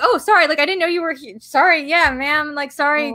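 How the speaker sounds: sheepish retreat, mimicked; mock apologetic. Mimics trolls suddenly backing down.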